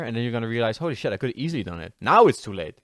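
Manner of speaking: ordinary talk, nothing marked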